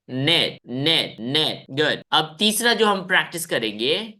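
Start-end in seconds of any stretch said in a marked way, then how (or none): 0.1-1.7 नेट said slowly with silent K, as "net"; model pronunciation. Shown as a demonstration. Teacher modelling नेट for students to repeat, slow and clear. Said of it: "तीन बार practice करते हैं".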